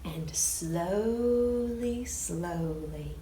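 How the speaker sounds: slow, soft, drawn-out slowly; slow and hushed. A narrator's reading. Storyteller slows right down near the end, soft and slow. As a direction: stretch it out, softly.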